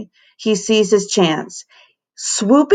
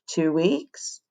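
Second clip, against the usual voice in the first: soft groaning class; 6 dB quieter than usual. Acted. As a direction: groan it together. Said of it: "Everyone but Emberly groaned".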